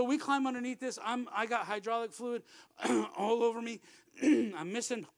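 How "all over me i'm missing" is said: ordinary talk, nothing marked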